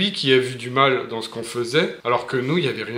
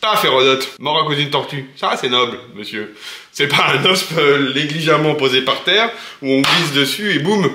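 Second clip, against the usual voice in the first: loud, punchy mock-heroic telling; big emphatic raconteur; dramatic storytelling; 5 dB louder than usual. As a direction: play up the absurd death like a grand tragedy. Dramatising Herodotus' absurd death.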